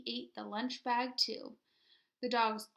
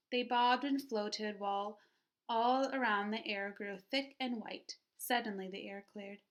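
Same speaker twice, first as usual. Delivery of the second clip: plain reading voice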